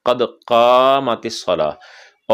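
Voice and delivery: very slow कद कामतिस सलाह, long aa; careful recitation. Shown as a demonstration. Teacher reciting the iqamah phrase slowly. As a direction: draw every syllable out. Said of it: "काफ के बाद अलिफ है".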